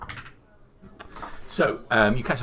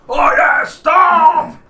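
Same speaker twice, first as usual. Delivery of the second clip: shout; 18 dB louder, much slower than usual. Acted. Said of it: "one of them stops".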